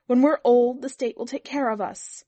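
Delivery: trembling outburst. A narrator's reading. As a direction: burst out in heated protest.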